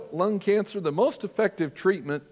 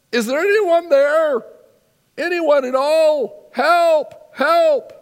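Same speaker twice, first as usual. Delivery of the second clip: desperate cries, much higher and louder; calling for help; 10 semitones higher, 8 dB louder, slower than usual. Retold or imitated. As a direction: shout up into the dark, desperate. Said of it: "he called out".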